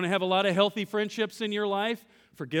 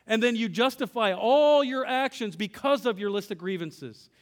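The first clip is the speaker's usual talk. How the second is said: ordinary talk, nothing marked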